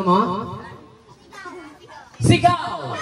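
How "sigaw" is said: high game-host cry; called-out command. Real, not acted. Host orders players to shout, high called-out command.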